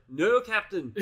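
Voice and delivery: hammy Scottish engineer brogue; Scottish accent. Retold or imitated. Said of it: "that'll be my worst Scottish accent there".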